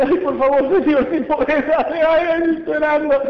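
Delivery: high, pleading, whiny voice; pleading man. Acted. The father begging Jesus for help, high pleading whine. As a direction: beg like a desperate father. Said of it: "El hombre le dice".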